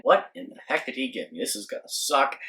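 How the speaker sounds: dropped-down, disappointed muttering to self; private grumbling thought. Retold or imitated. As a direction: grumble it quietly as a hidden thought. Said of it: "in my mind, I'm thinking".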